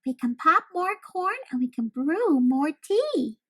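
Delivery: high, loud little mouse voice; a tiny mouse hurrying to cheer the bear; high squeaky reassurance; squeaky comforting mouse. A narrator's reading. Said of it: "Mouse squeaks".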